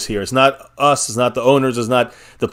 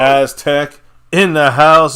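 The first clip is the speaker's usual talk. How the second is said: hype shout-out; 2 semitones higher, 4 dB louder, much slower than usual. Acted. Hyping a fan's donation.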